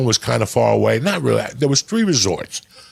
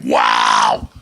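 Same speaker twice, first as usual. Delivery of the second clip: loud, low, drawn-out wow of disbelief; stunned wow; 13 semitones lower, 5 dB louder than usual. Retold or imitated. Offered mushrooms by strangers, a loud stunned wow. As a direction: blown away by what they're offering.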